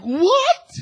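high, loud cry of disbelief; shocked outburst. Retold or imitated. Son hears the family secret from dad, shocked outburst. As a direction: yelp it, imitating a stunned son.

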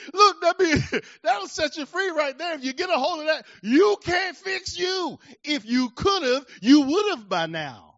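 loud and high, laughing through the words; laughing, exultant preaching. Real, not acted. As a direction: laugh with joy while you shout the truth. Laughing climax of the sermon.

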